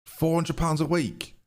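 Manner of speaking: high, slow, stunned question; can't believe the wage he just agreed; shocked disbelief. Real, not acted. Streamer stunned by new signing's wage, high and slow.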